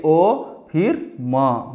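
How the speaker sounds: म drawn out slowly; slow spelling. Shown as a demonstration. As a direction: sound out the letter. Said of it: "spelling करेंगे". (धीमे हिज्जे)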